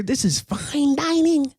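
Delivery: high slow mock fancy voice. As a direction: put on a high fancy-diner air. Mock posh quote.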